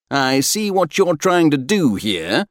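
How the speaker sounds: high, playful mock accusation; amiable accusation. A narrator's reading. Crewmate catching his friend's scheme, amiably accusing. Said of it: "Fenton accused amiably".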